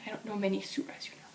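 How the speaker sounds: soft whispered aside; sheepish whisper to herself. Acted. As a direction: breathe it out under your breath. Whispered confession before prayer.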